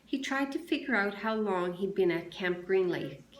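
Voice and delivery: plain reading voice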